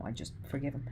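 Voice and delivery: normal talking voice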